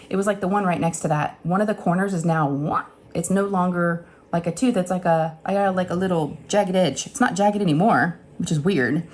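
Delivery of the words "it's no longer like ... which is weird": ordinary delivery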